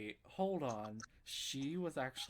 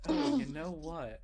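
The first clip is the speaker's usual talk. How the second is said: loud, slow, acted resolve; retold sudden resolve; 3 semitones higher, 10 dB louder, slower than usual. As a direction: announce a mischievous plan with gusto. Acts out his scheming younger self.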